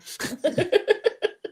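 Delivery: breathy high burst of laughter. Real, not acted. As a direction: laugh at own story. Laughing at own story.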